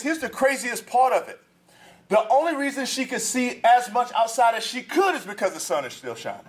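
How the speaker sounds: a pastor climbing into full sermon voice; preaching at full force. Acted. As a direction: drive the point home to the congregation.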